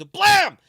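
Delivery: loud high shouted "blam"; sudden explosive comic bang; imitated gun blast. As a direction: burst out the shotgun blast. Imitates the shotgun blast in joke.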